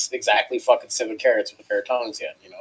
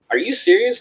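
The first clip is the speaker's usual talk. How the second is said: high, loud incredulous comeback; retold disbelief; 10 semitones higher, 5 dB louder than usual. Cook answering a screaming boss, retold incredulity. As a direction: replay your baffled protest.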